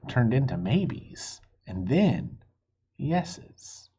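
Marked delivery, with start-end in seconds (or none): none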